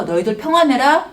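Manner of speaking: loud declaration with a lifted ending; proclaiming peace. Acted. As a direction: proclaim it out to the disciples. Jesus proclaiming peace, first take.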